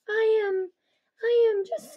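put-on frightened girl stammering. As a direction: trip over the words in fear. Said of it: "Stop stammering".

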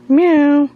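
high, loud playful meow; cute mock cat meow; imitated meow. Meowing at the cat.

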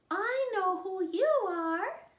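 put-on baby bird happy. Baby bird recognizing his mother, high happy chick voice. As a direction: say it like a delighted chick. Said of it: "said the baby bird".

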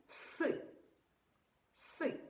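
С said hard, unpalatalized, as an isolated sound. Shown as a demonstration. Teacher sounding out слон with children, hard С. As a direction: repeat a hard, separate С. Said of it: "вимовляємо твердо".

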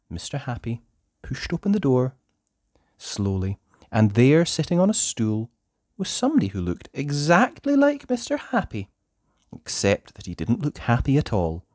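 plain reading voice

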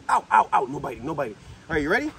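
normal talking voice

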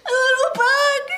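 high, loud, crying alarm; panicking child. Retold or imitated. Aunt imitating her niece's loud, panicked cry about a bug. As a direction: cry out like a frightened little girl. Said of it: "And she goes".

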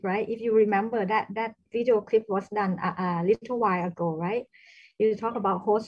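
loud, projecting voice. Real, not acted. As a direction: speak up so they can hear. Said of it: "can, can you speak louder".

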